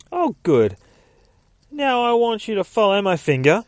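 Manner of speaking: high, loud voice to a waking patient; put-on voice. A narrator's reading. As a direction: chirp instructions up high. The voice speaking to waking Bruce.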